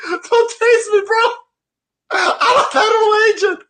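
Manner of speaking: high, wailing mock cry; mocking crybaby. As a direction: whine it like a crybaby. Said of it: "mocking the agent".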